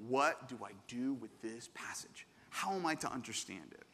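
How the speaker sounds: whispered puzzled question, soft; confused whisper. Retold or imitated. Pastor voicing a puzzled reader, soft whisper. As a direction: hush it like a lost reader.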